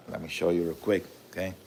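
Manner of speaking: ordinary talk, nothing marked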